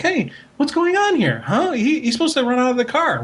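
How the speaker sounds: put-on high, bewildered dog calling for its missing boy. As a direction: voice the confused family dog looking for the boy. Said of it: "he was like".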